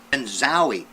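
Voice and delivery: loud excited zowie; zowie exclamation. A narrator's reading. Narrator bursting out as the stink strikes again. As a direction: punch it like a surprise.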